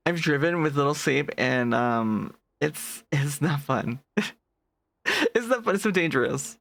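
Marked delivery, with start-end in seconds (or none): none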